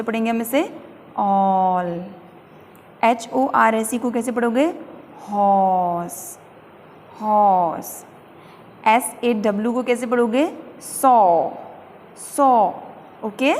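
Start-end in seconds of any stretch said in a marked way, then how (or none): 1.2-1.9 "ऑल" with the "aw" held long, pitch low; long open O. Shown as a demonstration. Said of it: "ये थोड़ा लंबा चलेगा". 5.2-8.0 "हॉर्स" with a very long "aw", R not sounded; long open O. Shown as a demonstration. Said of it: "आ थोड़ा और लंबा सा खिंचता है". 10.8-12.7 "सॉ" loud, with a long falling "aw"; long open O. Shown as a demonstration. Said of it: "आ थोड़ा और लंबा सा खिंचता है".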